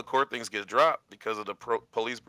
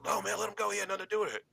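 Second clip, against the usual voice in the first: higher, softer voice for a pleading friend; retold plea, pitched well up and held high; acted-out appeal in a raised pitch; imitating friend pleading; 9 semitones higher, 5 dB quieter, faster than usual.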